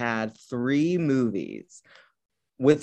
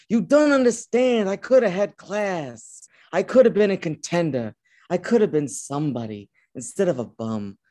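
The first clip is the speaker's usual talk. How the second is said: put-on slipping into a Brando-style accent; 4 semitones higher than usual. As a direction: deliver it as Brando's dockside monologue, in his accent. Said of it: "Not in the Brando accent".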